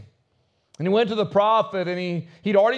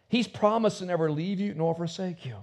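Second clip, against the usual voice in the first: ordinary talk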